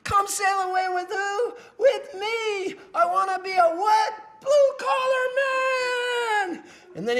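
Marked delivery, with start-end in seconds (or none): 0.0-1.5 high-pitched call-and-response prompt; hammed-up DJ holler; hype-man emcee. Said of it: "He goes". 1.8-2.8 high mock crowd yell; hammed-up call-back; imitated crowd response. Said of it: "And they go". 2.9-4.1 high-pitched call-and-response prompt; hammed-up DJ holler; hype-man emcee. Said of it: "He goes". 4.4-6.6 hammed-up crowd holler; imitated crowd response. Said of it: "And they go".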